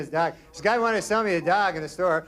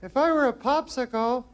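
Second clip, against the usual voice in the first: put-on high, breathy-free girly coo, much higher; 8 semitones higher than usual.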